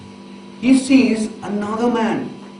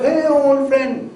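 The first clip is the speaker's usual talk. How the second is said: calling out; 7 semitones higher, slower than usual. Retold or imitated. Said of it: "And he calls out".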